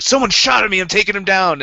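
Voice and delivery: high, loud excited charge; mock battle cry. Friend retelling your call after being shot, high loud mock battle cry. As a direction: charge in, hammed-up and eager.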